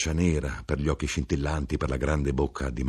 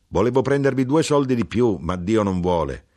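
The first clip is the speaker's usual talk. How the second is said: higher, louder character voice; knife-grinder speaking up; 5 semitones higher, 5 dB louder than usual. Acted. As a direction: voice the knife-grinder with more lift and volume.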